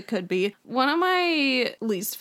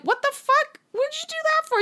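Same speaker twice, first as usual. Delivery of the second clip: slapped uncle. Retold or imitated. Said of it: "being like".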